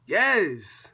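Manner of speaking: very loud, high yelled answer; real exasperated shout. Real, not acted. Vlogger answering intruder on camera, exasperated shout. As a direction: shout it to shut the questioning down.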